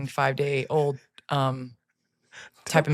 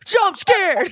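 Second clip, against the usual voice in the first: much higher, louder yelp; mock shout; 12 semitones higher, 8 dB louder than usual. Host jump-scaring opponents again, louder mock shout. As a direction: yell it to spook someone. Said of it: "run up to opponents and be like".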